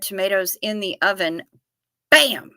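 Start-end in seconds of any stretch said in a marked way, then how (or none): 2.1-2.5 high and loud, a TV chef's shout; chef's bam. Pretend. Reading a recipe to chat, chef's shouted bam. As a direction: punch it out like a celebrity chef. Chef catchphrase exclamation.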